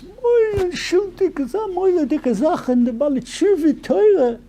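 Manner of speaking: put-on high, slow old Rebbe intoning; sing-song quote from the Rebbe, drawn out; high, slow Yiddish intonation of an old rabbi; Rebbe's voice. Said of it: "And he says".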